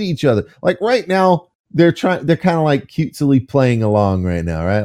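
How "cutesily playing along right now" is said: normal talking voice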